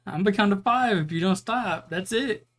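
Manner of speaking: high-pitched scolding parent's ultimatum; playacted countdown threat; mock mom warning. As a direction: raise the pitch like a parent losing patience.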